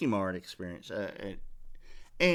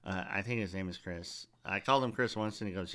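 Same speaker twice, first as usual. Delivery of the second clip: usual speaking voice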